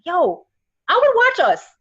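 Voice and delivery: high, loud eager exclaim; excited retold quote. Pitching a show idea to her girlfriend, excited. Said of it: "And I was like".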